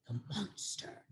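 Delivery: slow, breathy whisper; spooky whisper. A narrator's reading. Owl naming the monster to the crowd, hushed. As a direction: whisper it like a ghost story.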